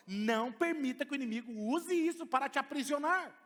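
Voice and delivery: loud, high preaching to the church; full-force preaching. Acted. Pastor exhorting the congregation, loud and high. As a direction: preach it out with power.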